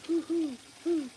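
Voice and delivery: high, slow hoo hoo like an owl; owl hoot imitation.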